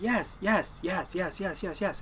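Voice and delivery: mildly exasperated, repeated assent; fed-up yeses. Retold or imitated. Nephew answering his uncle's repeated asking, mildly fed up. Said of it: "I got kinda sick and tired".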